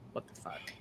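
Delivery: breathy whispered what the fuck, barely audible; whispered disbelief. Real, not acted. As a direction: mutter it under your breath in disbelief. Reading more bad news.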